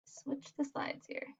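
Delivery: half-whispered note to herself; whispered aside. Real, not acted. Teacher muttering to herself while switching slides, whispered aside. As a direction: breathe it off-mic while fumbling the slides.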